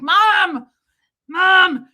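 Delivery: high, loud "Mom!"; exasperated daughter. Retold or imitated. Daughter stopping mom's put-on accent, exasperated call. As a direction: cut mom off, embarrassed and exasperated. Scolds her mother in story.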